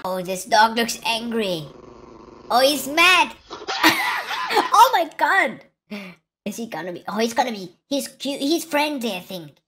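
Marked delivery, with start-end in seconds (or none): 3.5-4.7 very high squeal of laughter; shrieking laugh. Real, not acted. Laughing at angry dog.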